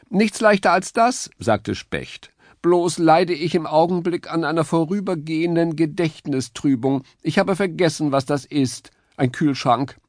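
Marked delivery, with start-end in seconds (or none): 0.1-1.3 very high, chirpy bird voice; a pitched-up cheerful bird; high woodpecker voice. A narrator's reading. Woodpecker character voice. 2.6-10.0 very high, chirpy bird voice; a pitched-up cheerful bird; high woodpecker voice. A narrator's reading. Woodpecker character voice.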